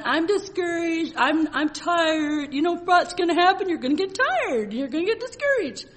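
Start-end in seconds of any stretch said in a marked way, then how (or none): 0.0-2.5 mock moping. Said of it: "You go around moping and saying".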